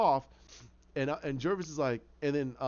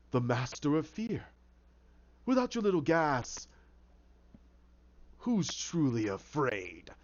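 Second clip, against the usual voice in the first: sinister taunt; slower than usual. Acted. Hatter taunting Crane without his gas, sinister.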